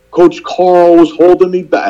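put-on complaining kid. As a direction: whine it like a kid tattling on coach. Upset kid's complaint, retold.

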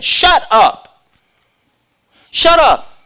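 high, loud angry shut up; rebuking crowd. Retold or imitated. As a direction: silence him harshly. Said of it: "They rebuked him".

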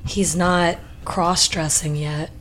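ordinary talk, nothing marked

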